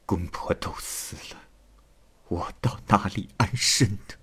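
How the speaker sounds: 带着气声，轻声悲诉; whispery, tearful lament, very soft; 含泪哭诉. A narrator's reading. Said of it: "他流着泪说". (流着泪低声哭诉)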